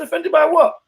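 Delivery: high, puzzled offended by what; mock-innocent high question; incredulous reply. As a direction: play dumb, pitch jumping up. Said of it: "I said".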